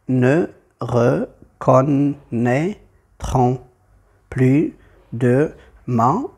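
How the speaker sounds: very slow, separated syllables; deliberate scansion with gaps between syllables. Shown as a demonstration. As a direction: count out the verse syllable by syllable. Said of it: "ce sera huit".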